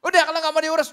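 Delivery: loud, high outburst of exasperation; re-enacted pastor losing his temper; fed-up angry shout. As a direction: yell an ultimatum down the phone. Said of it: "saya juga kesel juga".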